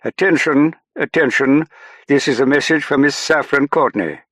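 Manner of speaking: loud, high-pitched English tannoy announcement; very English announcer. A narrator's reading. English officer paging Miss Courtney over the ship's tannoy, very English. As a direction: call it out in a very English voice. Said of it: "a very English voice saying".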